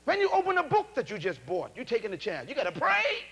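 usual speaking voice

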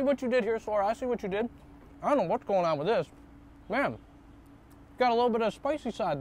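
talking with mouth full; words muffled by food; chewing while talking. Real, not acted. Seen 1: Lips closed and working, chopsticks at mouth between bites throughout.

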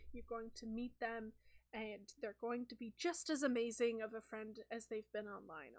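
low, soft calming voice; soothing reassurance. Acted. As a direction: settle a panicking friend gently. Sock puppet Kyle reassures Sky.

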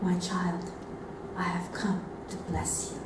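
soft, low, gentle blessing; Jesus. Retold or imitated. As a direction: speak a tender blessing very quietly. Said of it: "Jesus said to me".

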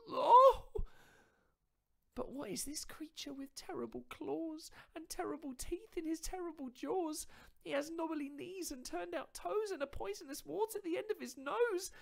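very high, squeaky, rising panic; a mouse seeing its made-up monster; terrified mouse. Acted. Mouse facing the real Gruffalo, high and terrified.

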